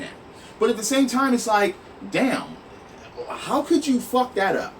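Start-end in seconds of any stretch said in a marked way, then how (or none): none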